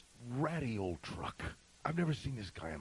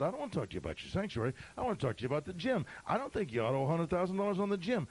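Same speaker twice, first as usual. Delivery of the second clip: quick, pressing, higher-pitched retort; insistent arguing; 7 semitones higher, faster than usual. Retold or imitated. Stranger pressing the pastor about the gym, insistent arguing. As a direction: push the point in a rapid run.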